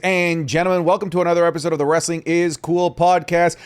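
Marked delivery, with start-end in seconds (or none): 0.0-2.6 announcer intro. Acted.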